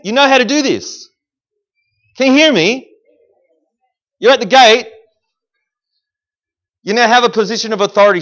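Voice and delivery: loud, high fervent sermon; preaching at full force. Acted. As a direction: drive it home to the congregation.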